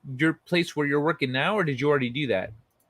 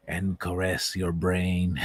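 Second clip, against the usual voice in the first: low, slow, gentle sultry murmur; 8 semitones lower, slower than usual. Pretend. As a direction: lull them to sleep with a sultry drawl. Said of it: "gentle, sultry voice".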